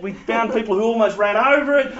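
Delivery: usual speaking voice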